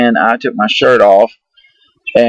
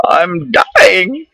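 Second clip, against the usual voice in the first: a half-hearted mock sob, pitched up; imitating bawling; 8 semitones higher than usual. Saying goodbye to his kids, a mild mock sob. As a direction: whimper it like a retold breakdown. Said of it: "I was bawling".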